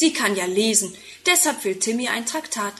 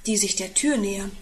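ordinary delivery, nothing marked

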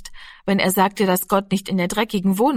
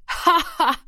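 high scornful laugh; older brother laughs scornfully at the little boy's belief; mocking laugh; 10 semitones higher than usual. A narrator's reading. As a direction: laugh at him, openly mocking. Giro laughs at Rami.

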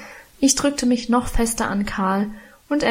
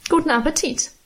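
higher, curt and snippy; 6 semitones higher than usual. A narrator's reading. Minchen locking the children in, snippy. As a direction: toss it off with a sneer. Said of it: "sagte schnippisch".

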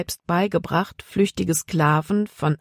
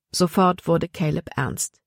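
ordinary delivery

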